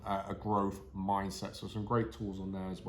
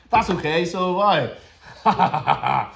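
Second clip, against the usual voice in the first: playful villain glee; 7 semitones higher, 12 dB louder, much slower than usual. Pretend. Replies to evil laugh playfully.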